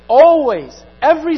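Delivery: one loud word, all conviction; emphatic shout. Real, not acted. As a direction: belt out "Always" loud. Preacher driving point home.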